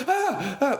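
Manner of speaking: high laughing yelp of fright; a hammed-up frightened squeal; mock startled yelp. Comedian recalls being caught, high laughing mock yelp.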